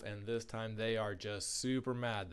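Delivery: plain reading voice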